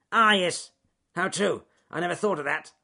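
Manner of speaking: high, loud, grumbling beaver voice; a hint of a grudging beaver; muttering beaver. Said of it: "muttered Ben the bad-tempered beaver".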